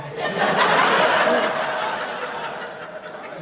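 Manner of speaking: loud breathy burst of laughter; really laughing it out; real laugh at the memory; big laugh. Real, not acted. Laughs at his own story.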